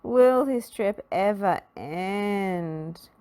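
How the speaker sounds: slow, weary groan; a fed-up traveller groaning. A narrator's reading. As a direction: drag it out wearily. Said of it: "groaned Mister Grumpy".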